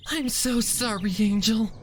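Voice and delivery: crying apology. Acted. Fluttershy cries to her lost pet, apologizing. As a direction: sob out the apology. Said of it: "Fluttershy cried".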